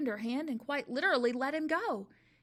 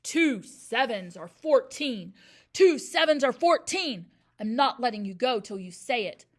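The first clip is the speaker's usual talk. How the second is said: bellowed; 12 dB louder than usual. A narrator's reading. Headmistress dangling the boy by his hair, bellowing. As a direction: hammer each word in a roar. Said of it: "Miss Trunchbull bellowed".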